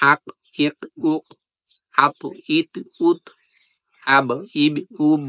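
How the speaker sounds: আক, ইক, উক, আত, ইত, উত, আব, ইব, উব with each final consonant bounced out; qalqala bounce. Shown as a demonstration. Teacher demonstrating the qalqala bounce on each letter. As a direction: jolt every closing consonant. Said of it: "ধাক্কা দিয়ে পড়ার কারণে".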